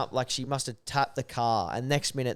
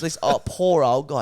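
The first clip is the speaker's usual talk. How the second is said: ordinary talk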